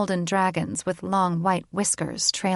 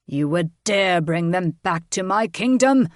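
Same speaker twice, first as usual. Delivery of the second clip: protesting; 4 dB louder than usual. A narrator's reading. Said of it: "Tormund protested".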